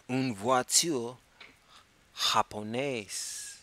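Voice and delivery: "Une voiture japonaise" said slow and clear; slow model phrase. Shown as a demonstration. Teacher modelling French for students, slow and clear. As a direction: spell each word out slowly for learners. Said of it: "teacher model phrase".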